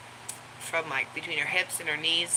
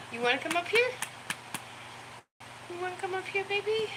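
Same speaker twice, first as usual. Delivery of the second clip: pet talk; 13 semitones higher, 4 dB quieter, much faster than usual. Real, not acted. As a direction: sweet-talk the dog up onto your lap. Coaxing dog up on lap.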